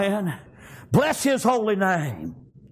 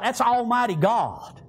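ordinary delivery

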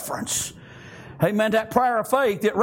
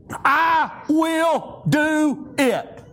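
"I will do it" very slowly, each word separate; weighty, spaced-out stress on each word of "I will do it"; emphatic word-by-word; 3 semitones higher, much slower than usual. Real, not acted. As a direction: stretch every word of "I will do it" with pauses between. Preacher drives home scripture promise.

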